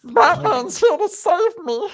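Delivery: Gollum voice. Pretend. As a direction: hiss it like Gollum clinging to his master. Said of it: "can you do Gollum".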